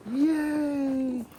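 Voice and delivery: high cheering yay. Real, not acted. Cheering for the pigs in their new pen. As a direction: squeal a happy yay.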